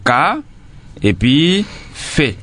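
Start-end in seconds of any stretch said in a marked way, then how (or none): 0.1-0.4 "Ka" alone, held and rising; separated syllable. Shown as a demonstration. Teacher splitting café for pupils, first syllable on its own. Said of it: "Deux fois". 1.9-2.4 "fait" alone as a long fé; separated syllable. Shown as a demonstration. Teacher splitting café for pupils, last syllable on its own. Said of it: "il y a deux syllabes".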